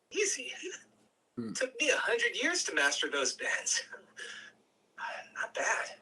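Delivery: lightly voiced Gabriel, easy and amused; a hint of an old master teasing; easygoing Gabriel. Gabriel praising Zion's flying, easy and amused.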